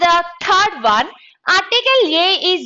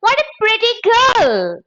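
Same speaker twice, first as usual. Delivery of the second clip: high-pitched exclamation of admiration; demonstrated exclamation, pitched well up; exclamatory; 9 semitones higher, 3 dB louder than usual. Shown as a demonstration. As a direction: exclaim it as an example sentence. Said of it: "used in exclamatory sentences like".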